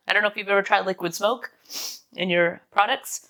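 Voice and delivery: ordinary talk, nothing marked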